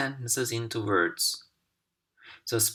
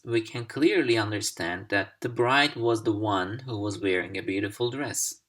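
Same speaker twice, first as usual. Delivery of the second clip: normal talking voice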